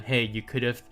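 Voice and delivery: normal talking voice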